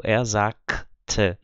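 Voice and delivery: "sagte" with a clear k sound, zakte; standard sagte. Shown as a demonstration. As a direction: bite the g of sagte as a crisp k. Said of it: "com som de K".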